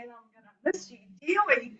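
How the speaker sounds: ordinary talk, nothing marked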